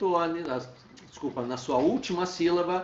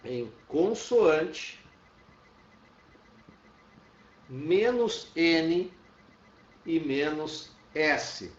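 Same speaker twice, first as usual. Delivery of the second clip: slow dictation; much slower than usual. Shown as a demonstration. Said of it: "teacher dictating rule slowly".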